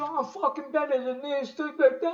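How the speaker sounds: high, whiny mock of an angry friend; hammed-up high whine of a pissed-off chess rival; whiny sore loser. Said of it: "Mike got fucking pissed".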